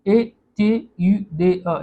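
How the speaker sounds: slow letter-by-letter spelling of "ए टी यू डे अ". Shown as a demonstration. Teacher spelling a French word for students, slow and deliberate. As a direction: spell "ए टी यू डे अ" slowly, one letter at a time. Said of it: "पहला वाला देखते हैं".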